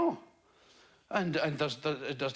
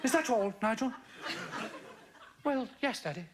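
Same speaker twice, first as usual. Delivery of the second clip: put-on lightly posh English accent, father and son; a hint of plummy English, a vicar voicing his boy; faster than usual. Radio vicar retells a chat with his son, light posh English accent. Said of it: "Pretty good English accent".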